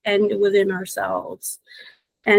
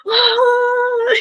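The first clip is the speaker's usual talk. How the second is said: frustrated cry; 14 semitones higher, 4 dB louder than usual. Retold or imitated. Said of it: "And I'm like".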